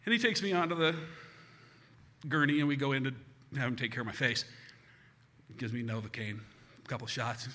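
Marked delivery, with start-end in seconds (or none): none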